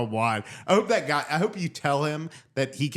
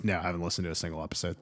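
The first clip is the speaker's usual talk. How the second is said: brother's quick reply; 7 semitones lower, 7 dB quieter, much faster than usual. Retold or imitated. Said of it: "He says".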